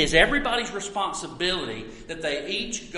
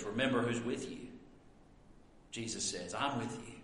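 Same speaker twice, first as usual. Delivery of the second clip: much softer and lower, tender; soft reassurance; 7 semitones lower, 13 dB quieter than usual. Retold or imitated. Preacher voicing Jesus reassuring disciples, soft and tender. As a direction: comfort them gently.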